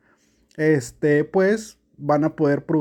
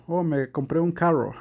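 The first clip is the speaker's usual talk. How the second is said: imitating an American learner of Spanish; American accent, English r in carro; acento gringo. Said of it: "dice carro". (imitando el acento de un gringo)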